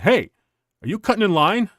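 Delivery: mock-indignant challenge from a stranger; pissed off. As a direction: call out a retold accusation, mildly irritated. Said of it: "he's got this pissed off look".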